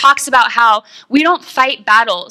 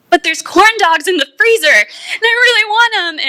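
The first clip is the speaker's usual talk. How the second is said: mock craving whine; 11 semitones higher, 4 dB louder than usual. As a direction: plead like a kid who wants a snack. Retelling her own craving.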